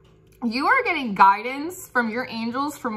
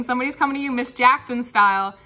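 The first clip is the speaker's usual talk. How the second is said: normal talking voice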